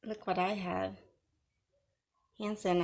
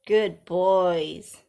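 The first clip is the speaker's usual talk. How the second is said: louder, mocking praise for pets; mock dog praise; 8 dB louder than usual. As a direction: praise them like dogs. Praising haters like dogs.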